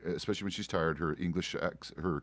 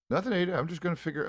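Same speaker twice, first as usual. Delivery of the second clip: teasing; 10 semitones higher, faster than usual. Real, not acted. Teasing Ada about clothes.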